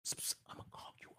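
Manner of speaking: quick hushed whisper, sneaking a promise; secret whisper. Retold or imitated. Man secretly whispering to her behind his wife, fast whisper. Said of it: "he's signaling that he's gonna call me".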